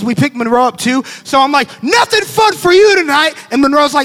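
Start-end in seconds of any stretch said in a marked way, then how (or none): 1.8-3.3 loud, high parental decree; hammed-up angry parent shouting; stern punishment. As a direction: lay down the law at a shout. Acted parent announcing punishment.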